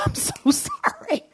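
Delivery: very high, slow whiny apology; child begging dad not to spank, squeaky and drawn out; pleading child. Retold or imitated. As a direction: drag out a squeaky, pleading sorry. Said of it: "and I'm like".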